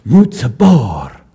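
plain reading voice

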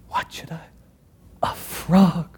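hushed whispered question. Acted. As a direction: wonder under your breath. Caliph wonders in secret.

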